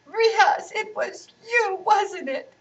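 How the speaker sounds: put-on high accusing child's yell; little girl yelling at her brother. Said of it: "yelled Shala".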